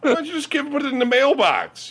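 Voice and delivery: high mock disbelief; exasperated mockery. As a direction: ask it like the answer is obvious. Mocking exasperation at Kevin.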